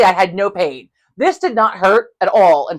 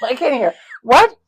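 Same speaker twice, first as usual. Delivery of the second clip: can't hear, confused; 7 semitones higher, 3 dB louder, slower than usual. Retold or imitated. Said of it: "And I'm like".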